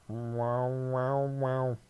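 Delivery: drawn-out sad wow-wow of a sick cat; a hint of a sad wailing cat; mournful cat wail. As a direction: make it sound mournful. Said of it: "sounds like this really, like, mournful, like, super sad".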